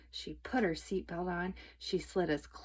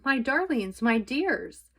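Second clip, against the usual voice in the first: loving; 6 semitones higher, 8 dB louder, slower than usual. A narrator's reading. Greeting family.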